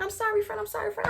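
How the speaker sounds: high, pleading mimicry of a friend; imitated apology. Voices Luna's apology to her.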